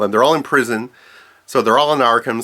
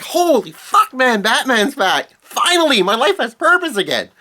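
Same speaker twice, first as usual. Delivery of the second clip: much higher, gleeful Joker voice; ecstatic Joker; 10 semitones higher than usual. Retold or imitated. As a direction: gush with giddy delight at his return. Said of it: "And he's so happy".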